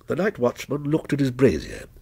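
plain reading voice

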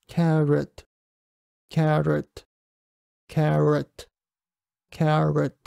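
"carrot" with a careful English R in the middle; isolated "carrot", tongue shaping the R differently each take; four R methods. Shown as a demonstration. Teacher reading words for comparison, four English R methods. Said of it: "全四つの方法でここに用意した単語を読んで".

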